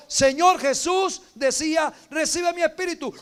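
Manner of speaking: loud, high cry to heaven; pleading shout. Acted. Dying Stephen crying out to the Lord, loud and high. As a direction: call out in pain but with love. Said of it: "Y gritó".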